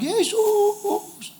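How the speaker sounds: a high call stretched out; high calling cry. Retold or imitated. Lepers calling Jesus from far off, high cry. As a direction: call his name across a distance. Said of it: "Teriak-teriak".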